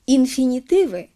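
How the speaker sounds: ordinary delivery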